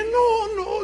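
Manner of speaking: high, loud sobbing protest; a child wailing no through tears; crying no. Retold or imitated.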